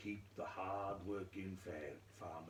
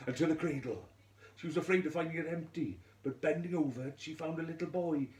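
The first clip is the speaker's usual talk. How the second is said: breathless urgency; 9 semitones higher, 8 dB louder, faster than usual. A narrator's reading.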